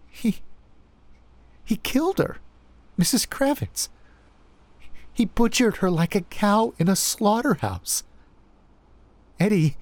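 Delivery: building from hushed shock to high panic; rising toward a scream. A narrator's reading. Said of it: "Her voice rose into a scream".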